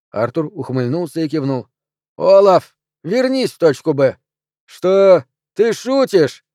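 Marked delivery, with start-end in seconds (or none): 2.2-4.2 higher, louder call over the speaker. Acted. Calling over intercom. 4.7-6.4 higher, louder disbelief; can't believe the request; incredulous. Acted. Incredulous annoyed scientist.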